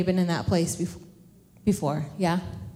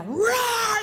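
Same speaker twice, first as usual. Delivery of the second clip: high, drawn-out roar; mock monster yell; 13 semitones higher than usual. Dad scaring kids from the dark, yelled roar. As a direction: pounce with a hammed-up roar. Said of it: "he'd be yelling".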